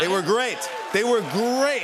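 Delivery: high, loud, building praise; excited raving. Real, not acted. Raving about the band.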